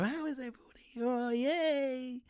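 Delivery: fake cheerful facade. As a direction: chirp it out like a mask of good cheer. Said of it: "I put that facade on all the fucking time".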